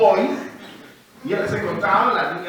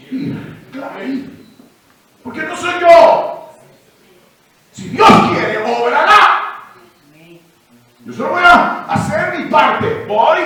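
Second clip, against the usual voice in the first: proclamación lenta y fuerte, más aguda; predicación enérgica; 9 semitones higher, 12 dB louder, slower than usual. Acted. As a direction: proclama con fervor de predicador. Preaching at full force. (predicación a pleno pulmón)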